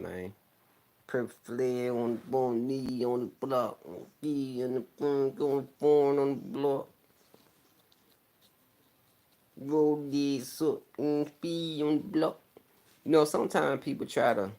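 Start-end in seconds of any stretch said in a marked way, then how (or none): 1.1-12.4 higher, slurred, slow mush-mouthed rap imitation; mock a rapper's garbled words, drawn out; unintelligible rapper. Fan mocking a rapper nobody could understand, slurred high imitation. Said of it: "I couldn't understand nothing he was saying".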